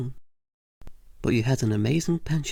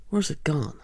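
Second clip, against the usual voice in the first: normal talking voice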